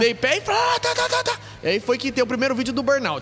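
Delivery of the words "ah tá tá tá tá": high, revving engine sound; engine noise imitation. Driver retelling the race imitates his engine revving. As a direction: mimic the car roaring off.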